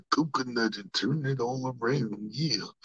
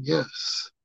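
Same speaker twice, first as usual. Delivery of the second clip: ordinary delivery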